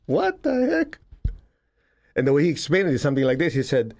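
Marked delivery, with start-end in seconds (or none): 0.1-1.0 much higher, mock baffled squeak; baffled classmates. Students reacting to the teacher's lesson, high baffled mock squeak. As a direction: play the stunned students. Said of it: "We in class were going like".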